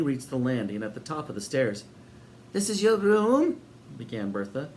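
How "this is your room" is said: high, sugary welcoming landlady voice; sinister hostess. A narrator's reading. Landlady welcoming Sid and Eric, high and sweet. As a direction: greet them grandly, a touch too sweet. Bertha presenting the room.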